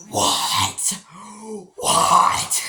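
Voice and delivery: loud breathy gasped cry; shocked outburst. Retold or imitated. Reviewer reacts to the Vanessa twist, loud shocked cry. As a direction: hit by the twist. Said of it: "at first I was just like".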